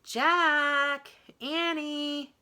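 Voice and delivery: high, loud call from far off; distant cry. A narrator's reading. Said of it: "came the distant cry again".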